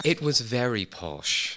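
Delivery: slow, drawn-out posh British accent. Shown as a demonstration. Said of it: "can you play that just at will".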